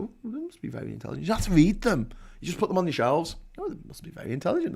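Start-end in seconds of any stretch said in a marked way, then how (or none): 0.0-1.3 quick, hushed mock admiration; imagined impressed visitor. Visitor admiring the bookshelves, lightly mocked awe. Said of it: "when people come around, they go". 3.6-4.7 high, rapid, soft mock awe; imagined impressed visitor. Visitor admiring the unread books, mock impressed. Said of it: "when people come around, they go".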